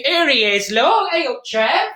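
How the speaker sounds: loud, high-pitched welcome; excited hail. Real, not acted. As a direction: greet your arriving partner with a lifted voice. Hailing Trev as he appears.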